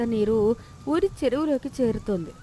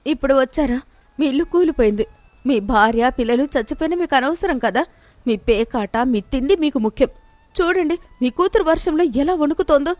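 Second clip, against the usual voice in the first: louder, higher reproach; bitter scolding wife; 4 semitones higher, 6 dB louder than usual. Acted. Wife scolding Suribabu for neglecting family, bitter. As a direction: lash out at the careless husband.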